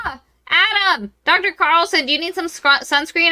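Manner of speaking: high-pitched mock call to someone. [name] hailing the professor about sunscreen, calling out high. [name] calls out to Adam.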